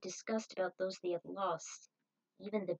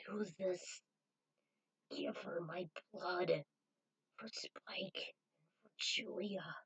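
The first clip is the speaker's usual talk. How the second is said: dying whisper; slower than usual. Acted. Dying Victoria begs Zero to give her blood, whispered. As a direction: breathe the words out with the last strength. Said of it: "Her voice was nearly audible".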